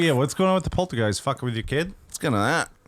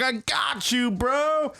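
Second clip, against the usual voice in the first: much higher, chummy and upbeat; high bro voice; 11 semitones higher, much slower than usual. Retold or imitated. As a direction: toss it off like a hyped bro. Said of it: "I was like".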